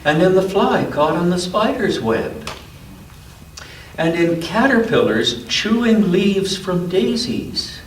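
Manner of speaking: gentle storybook read-aloud; unhurried picture-book narration; reading to a child. A narrator's reading.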